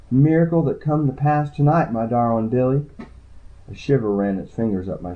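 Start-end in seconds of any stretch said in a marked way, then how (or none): none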